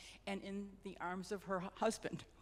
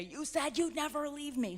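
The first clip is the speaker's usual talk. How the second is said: reproachful; 7 semitones higher, 5 dB louder than usual. Retold or imitated. Widow scolding husband's picture.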